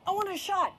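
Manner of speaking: put-on high, loud kid's demand; eager outburst. Boy begging the nurse for a shot, eager outburst.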